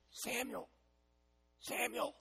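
hushed, high, faraway call; still small voice. Acted. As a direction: call the name very softly, barely audible. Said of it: "He heard a still small voice".

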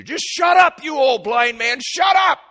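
high, loud jeering crowd yelling. Retold or imitated. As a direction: shout him down like a hostile crowd. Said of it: "what the crowd was telling Bartimaeus".

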